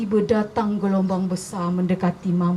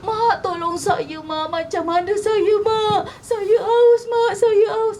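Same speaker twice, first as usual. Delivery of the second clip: high, whining wail to mother; crying child's plea; 11 semitones higher, slower than usual. A narrator's reading. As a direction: plead in a high voice, rising as the thirst bites. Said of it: "Dia nangis-nangis". (merengek sambil menangis, nada tinggi)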